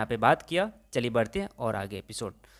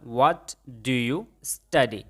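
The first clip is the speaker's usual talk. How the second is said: very slow, word by word; slow model sentence; much slower than usual. Shown as a demonstration. Teacher shows students the English, very slowly. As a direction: spell it out slowly for learners. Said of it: "तो यहाँ पे मैंने बोला".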